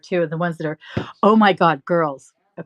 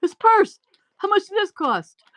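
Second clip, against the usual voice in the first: much higher, louder mock outrage at the purse; imitating shocked husband; 12 semitones higher, 5 dB louder than usual. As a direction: play the husband's high-pitched, indignant surprise. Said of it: "And he goes".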